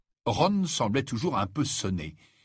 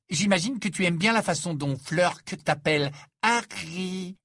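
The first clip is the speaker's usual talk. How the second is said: high, contemptuous needling; mock him with a disdainful question; scornful; 7 semitones higher than usual. Ginny taunts Harry about Fleur, scornful. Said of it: "demanda Ginny d'un air méprisant".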